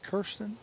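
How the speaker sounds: "Kirsten" said as KUR-stin; confirmed pronunciation. Real, not acted. Announcer confirming a player's name, "Kirsten" as KUR-stin. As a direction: answer with "Kirsten" on an "ur" vowel. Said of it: "Is it Kirsten or Kirsten?".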